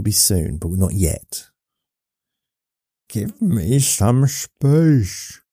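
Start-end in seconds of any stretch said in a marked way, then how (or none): 3.1-5.4 slow, high, drawn-out plea for space; put-on voice. Telling someone imagined to give room, put-on voice. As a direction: draw it out in a put-on voice. Acted outburst to imagined company.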